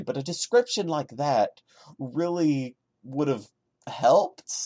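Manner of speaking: ordinary talk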